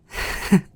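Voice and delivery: a quick laugh of relief; little airy laugh; short relieved laugh. Acted.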